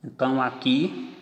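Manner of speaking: ordinary talk, nothing marked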